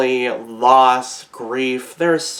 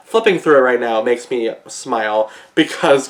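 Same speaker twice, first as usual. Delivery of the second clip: ordinary talk, nothing marked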